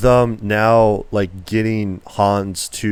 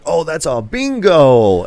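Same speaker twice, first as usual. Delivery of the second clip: mock Landa delight; 6 semitones higher, 4 dB louder, slower than usual. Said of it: "he just goes like".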